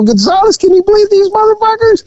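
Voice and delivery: high, squealing mock bystander; amused bystander. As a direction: squeal it out in disbelief. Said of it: "They go".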